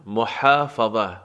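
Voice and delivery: محافظه spoken slowly, its ح pushed from mid-throat; slow demo of محافظه with a throaty ح; careful pronunciation. Shown as a demonstration. Teacher slowly sounding out محافظه for learners, throaty ح. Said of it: "ها از وسط حلق".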